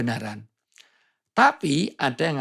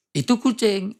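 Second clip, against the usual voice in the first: "Itu kucing" as its own phrase, pitched high; proper intonation; 7 semitones higher than usual. Shown as a demonstration. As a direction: set "Itu kucing" apart as a separate remark. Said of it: "itu supaya nggak kaget". (intonasi benar, "Itu kucing" diucapkan terpisah)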